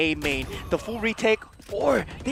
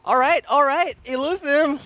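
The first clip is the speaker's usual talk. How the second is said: excited; 4 semitones higher, 4 dB louder than usual. Real, not acted. Caster hype after round.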